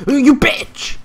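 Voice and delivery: very high yelled insult; outburst. Real, not acted. Gamer yelling after being killed. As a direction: spit it out in anger.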